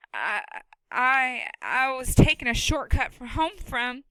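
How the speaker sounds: put-on a boy trying to talk his way out; nervous boy. Boy character voice.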